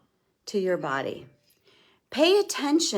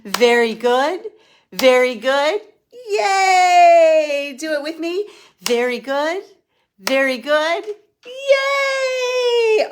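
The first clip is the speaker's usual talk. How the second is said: much higher, loud, joyful "very good" and shouted yays; excited cheering; 9 semitones higher, 11 dB louder, slower than usual. Real, not acted. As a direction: cheer everyone on like a delighted coach. Cheering on the group after the laughter.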